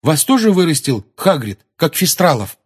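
put-on loud, high, eager boy's question; avidly curious. Dean quizzing the centaur about Hagrid, avidly curious. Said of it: "жадно спросил Дин".

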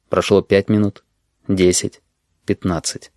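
usual speaking voice

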